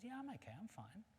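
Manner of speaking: high, soft squirrel voice; little squirrel. Acted. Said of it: "The squirrel says".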